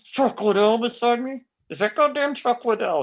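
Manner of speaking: high, breathless disbelief; can't believe who's standing there; fanboy freak-out; starstruck. Retold or imitated. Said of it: "And I'm like".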